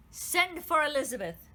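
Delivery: queen giving an urgent order; commanding. A narrator's reading. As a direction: order it at once. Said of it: "she commanded".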